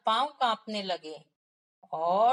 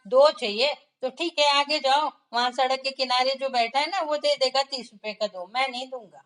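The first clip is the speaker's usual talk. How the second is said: louder, higher irritated shopkeeper; angry shopkeeper; 4 semitones higher, 6 dB louder than usual. Acted. Shopkeeper angrily sends the stingy customer to another seller. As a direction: snap at the haggling customer to move along. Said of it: "दुकानदार को गुस्सा आया".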